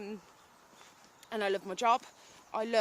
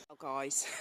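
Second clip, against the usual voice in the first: laughing to herself; 7 semitones lower, 4 dB quieter than usual. Real, not acted. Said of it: "I'm laughing to myself".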